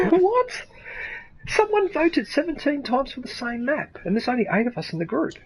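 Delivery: high, squeaky-pitched disbelief; incredulous. Real, not acted. Player baffled by seventeen votes from eight people, incredulous. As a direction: pitch it way up in amazement.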